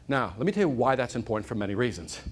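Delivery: ordinary delivery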